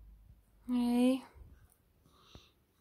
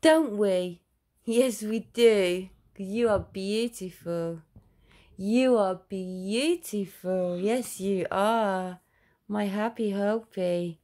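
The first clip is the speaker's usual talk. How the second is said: louder, drawn-out sing-song praise; loud baby talk; 3 semitones higher, 7 dB louder, much slower than usual. Acted. Mother praising her daughter after a seizure, loud slow baby talk. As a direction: beam it out slowly, big and fond.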